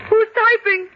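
high, shrill, loud cry of fear; panicked shriek; terrified question. Acted. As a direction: demand an answer in terror. Terrified at ghostly typing.

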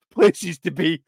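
deadpan quip that dissolves in a laugh; amused mock seriousness. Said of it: "Train's like that".

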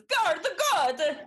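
high sing-song Swedish voice; Swedish accent. Shown as a demonstration. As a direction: lilt it Swedish style. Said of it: "do you know how to do a Swedish accent?".

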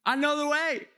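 mock-confident guide urging people on. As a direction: sell it like you know the way. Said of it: "people who are hopping out of the car going".

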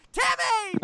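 high loud victory yell; triumphant shout. Pretend. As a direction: shout it out like you just won. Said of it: "you have to be like".

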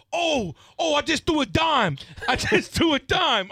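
high, drawn-out mock triumph; slow, high-pitched retold exclamation; excited kid. Retold kid thrilled by throw.

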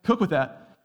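high, loud neighbor asking for their dish back; nagging neighbor. Retold or imitated. Neighbor demanding the Pyrex back, loud and pointed. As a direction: call it out loud and pointed, half-joking. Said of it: "You're like".

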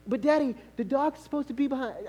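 put-on high-pitched child's objection; protesting little daughter. Daughter correcting her father about the dog, childlike protest. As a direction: pipe up like an insistent kid.

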